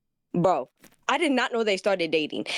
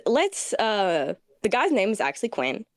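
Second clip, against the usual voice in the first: ordinary delivery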